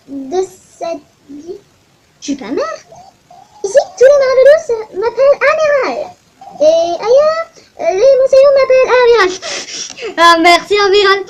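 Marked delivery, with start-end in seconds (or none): 3.6-11.2 put-on loud, high sea captain in a Russian accent; proud Russian-accented captain hailing the island. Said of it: "pourquoi je fais un accent russe?".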